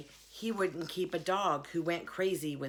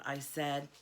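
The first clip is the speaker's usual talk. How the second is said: usual speaking voice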